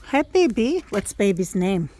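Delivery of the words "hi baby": high sing-song coo. Real, not acted. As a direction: lift it up sweetly.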